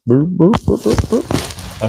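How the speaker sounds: high beeping boops, rising then breathy; imitated beeps. Shown as a demonstration. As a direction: mimic a device beeping. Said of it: "it goes like this".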